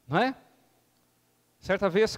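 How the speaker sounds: normal talking voice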